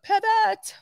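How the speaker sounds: high-pitched sitcom quote; mock sitcom cry; Friends impression. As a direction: call it out high, like the famous scene. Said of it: "That Friends episode".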